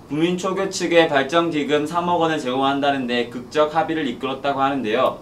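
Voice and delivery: ordinary delivery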